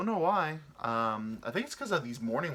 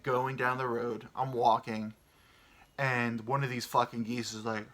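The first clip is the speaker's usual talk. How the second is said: normal talking voice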